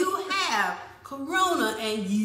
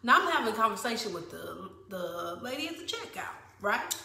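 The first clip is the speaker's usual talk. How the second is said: ordinary delivery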